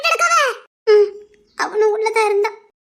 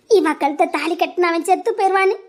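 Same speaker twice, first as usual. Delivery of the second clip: usual speaking voice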